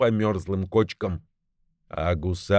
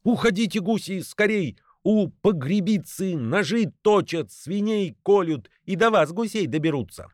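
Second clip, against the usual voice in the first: high, urgent cawing warning; frantic high-pitched bird alarm; jackdaw's alarm cry; 9 semitones higher than usual. A narrator's reading. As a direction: shout a warning to the geese, much higher. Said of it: "кричит".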